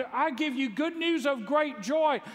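high, slow and ringing; an angel bursting with joy to the shepherds; joyful angel proclamation. Pretend. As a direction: announce it like a beaming herald. Angel's joyful announcement preached.

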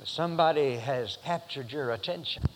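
ordinary talk

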